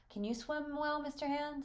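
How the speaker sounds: put-on high-pitched child's question; curious young boy. Boy asks visitor about swimming, high and curious. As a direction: ask brightly up high, like a little boy. Reader plays Archie asking.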